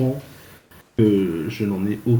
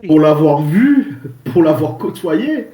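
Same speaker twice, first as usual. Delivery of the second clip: indignant; 7 semitones higher, 9 dB louder than usual. Acted. Indignant warning about a man.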